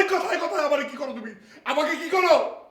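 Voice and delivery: usual speaking voice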